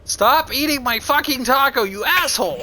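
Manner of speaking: angry yell. Acted. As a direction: yell it at a friend. Yelling at a friend.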